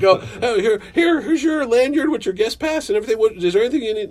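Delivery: high, eager and flustered; host fussing over his idol, high and eager; flustered fanboy. Retold or imitated. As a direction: fuss over him like a nervous fan. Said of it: "And I'm like".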